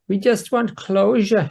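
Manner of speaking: high, slow sugary voice; sweetly. A narrator's reading. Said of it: "she grins sweetly".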